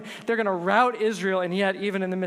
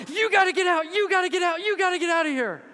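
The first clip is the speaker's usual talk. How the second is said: high, loud urgent shout; frantic high-pitched shouted warning; retold shout; 13 semitones higher, 5 dB louder than usual. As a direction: yell it like a warning to flee. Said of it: "he began to shout".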